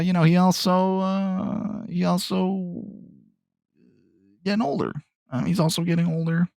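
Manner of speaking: high, lightly voiced cartoon Luigi; mock Luigi voice. Podcaster jokes Luigi is getting older, high cartoon voice.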